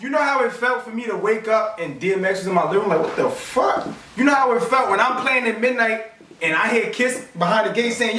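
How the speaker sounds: high, animated storytelling; hyped, high-pitched recollection; real excitement. Real, not acted. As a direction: relive it with amazed excitement. Excitedly recalls meeting his idols.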